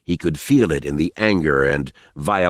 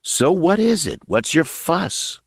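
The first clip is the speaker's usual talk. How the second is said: mock sad; 9 semitones higher than usual. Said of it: "mock sad".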